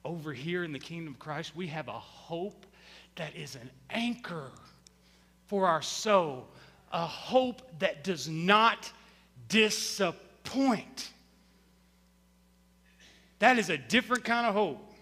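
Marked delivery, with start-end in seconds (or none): 0.0-11.1 tender assurance. Real, not acted. Tender contrast after mockery.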